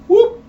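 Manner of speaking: high yelped whoop; a popping cartoon whoop; sudden squeaky sound effect; comic whoop sound. Mimics placenta being pulled.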